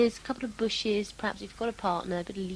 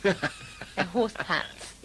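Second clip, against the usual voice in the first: slow insistence; much slower than usual. Shown as a demonstration. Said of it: "No, cow pat".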